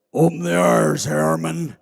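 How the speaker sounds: a drawled order where airman comes out as hairman; Southern slurred order. Retold or imitated. General orders the airman, lightly Southern drawled. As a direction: Open the iris said loud, airman slurred to sound like hairman. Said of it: "it didn't sound like the word airman".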